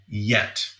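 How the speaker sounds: "yet" set apart at the end; stress on yet. Shown as a demonstration. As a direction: hang the implication on a separate "yet". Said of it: "That's a key word, yet".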